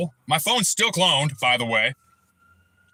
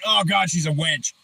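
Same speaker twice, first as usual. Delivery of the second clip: usual speaking voice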